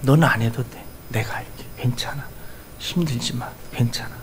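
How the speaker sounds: soft, fading to a whisper; God speaking tenderly to a tired believer; tender reassurance. Acted. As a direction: comfort a weary child gently. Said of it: "그렇게 얘기해요".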